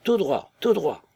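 calling out high and out of breath; panting soldier calling to his mate; breathless shout. A narrator's reading. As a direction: gasp it out while running. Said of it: "d'une voix essoufflée".

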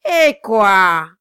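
very loud astonished cry; exclaim. A narrator's reading. Quilp cries out from the window, loud. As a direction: bellow the question. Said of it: "s'écria le nain".

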